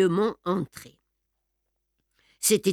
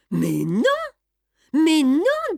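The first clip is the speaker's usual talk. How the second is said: astonished denial, leaping high on repeat; 8 semitones higher, 4 dB louder, slower than usual. A narrator's reading. Madame answers Beautrelet about missing pages, very astonished. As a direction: deny it in growing amazement. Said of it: "dit-elle très étonnée".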